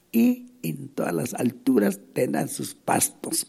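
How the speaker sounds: solemn Bible reading; scripture reading. Acted. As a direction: declaim the verses to the congregation. Reads Isaiah aloud mid-sermon.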